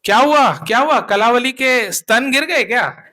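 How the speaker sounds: loud, high mocking tease; teasing. A narrator's reading. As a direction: poke fun at her loudly, grinning. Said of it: "अलग mood में थी". (चिढ़ाते हुए ऊँची आवाज़ में)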